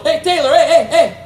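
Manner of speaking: high-pitched, excited teasing call; excited squeaky greeting; teasing janitor. Retold or imitated. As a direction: imitate João hooting hellos up high. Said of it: "he'll look at me and go".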